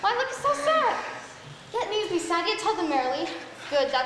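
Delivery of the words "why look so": excited. Acted. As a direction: burst out with glee. Juliet sees the nurse arrive.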